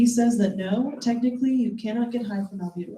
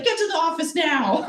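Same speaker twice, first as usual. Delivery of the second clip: higher, lightly voiced scolding teacher; 7 semitones higher than usual. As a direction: mimic a stern hall monitor. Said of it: "they'd be like".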